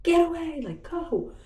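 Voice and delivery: high-pitched yell shooing pets; re-enacted scolding of the pets; retold yell. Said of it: "I was, like, yelling at the pets".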